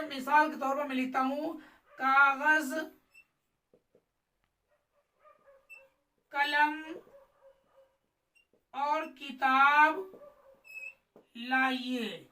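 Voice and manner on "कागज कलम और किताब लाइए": "कागज", "कलम", "और किताब", "लाइए" set apart by long pauses; long pauses between words. Shown as a demonstration. Teacher writing out an example sentence, word by word with long pauses. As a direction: stretch "किताब" and leave long gaps after "कागज" and "कलम". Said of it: "हमें यहाँ पर थोड़ी देर ठहरना चाहिए".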